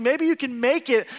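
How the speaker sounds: usual speaking voice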